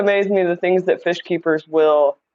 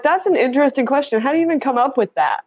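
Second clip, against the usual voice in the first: higher, quicker, a touch incredulous; mock amused reply; 7 semitones higher, faster than usual. Retelling her reply to a viewer's odd question, higher and quick. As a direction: toss off a wry, puzzled reaction. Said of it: "And I'm like".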